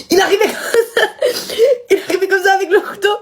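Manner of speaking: telling it through high laughter; laughing. Real, not acted. Recalling her father running in, laughing. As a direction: giggle through the words.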